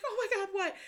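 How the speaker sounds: high, alarmed mimicked outburst; startled friend. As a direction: imitate a startled friend at the wheel. Startled friend while swerving.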